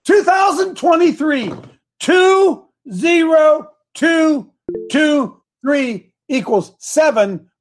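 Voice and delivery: high, slow, emphatic proclamation. Real, not acted. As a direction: spell out every digit slowly. Proclaiming the year loudly.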